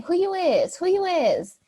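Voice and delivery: imitating a Louisiana local, pitch up; Louisiana slang. Imitating a Louisiana local asking the newcomer who she is. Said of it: "he was saying".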